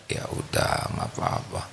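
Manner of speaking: soft, resigned whisper; whispered acceptance. Acted. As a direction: whisper it, letting it go. Husband calmly lets it go. (bisikan pasrah yang tenang)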